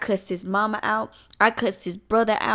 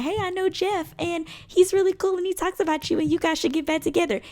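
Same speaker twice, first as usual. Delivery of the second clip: fake girl voice; 9 semitones higher than usual. Ex posing as a girl.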